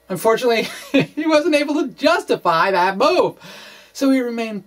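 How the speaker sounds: breaking into a laugh mid-sentence. Real, not acted. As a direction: chuckle through it, pitch lifted high. Wry remark on Maxwell's failure.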